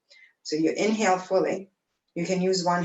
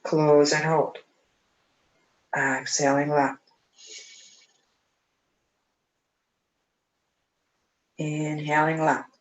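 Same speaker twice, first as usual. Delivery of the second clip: calm, even breathing instructor; guided breathing cues. Acted. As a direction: count the breath steadily and calmly. Leads alternate nostril breathing.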